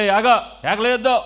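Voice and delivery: acted crowd scolding, high and loud; loud rebuke. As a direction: bark at him to stop shouting. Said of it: "అతన్ని చాలామంది గద్దిస్తున్నారట".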